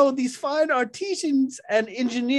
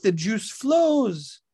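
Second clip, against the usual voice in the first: drawn-out admiring delivery; slow and grand; 6 semitones lower, slower than usual. Acted. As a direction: savor each word slowly. Caesar praising juicer, slowly.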